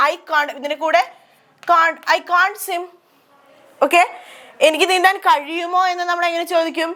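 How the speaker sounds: normal talking voice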